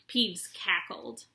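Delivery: ordinary delivery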